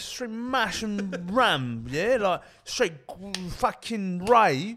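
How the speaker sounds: high, drawn-out British lad; mock British accent. Lad raves about mash in the bit, mock British accent. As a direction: stretch the words in a silly British accent. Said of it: "it's an easy impression".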